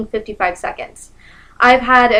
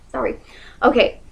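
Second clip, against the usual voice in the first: ordinary talk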